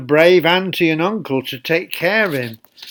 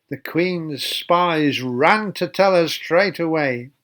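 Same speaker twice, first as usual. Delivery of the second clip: ordinary delivery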